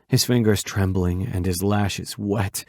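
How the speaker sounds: plain reading voice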